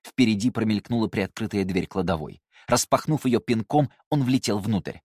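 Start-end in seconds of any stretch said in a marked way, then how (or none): none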